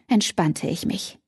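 usual speaking voice

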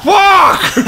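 sharp panicked cry; frantic curse. Real, not acted. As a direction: yell the curse high and loud. Getting hit in the game.

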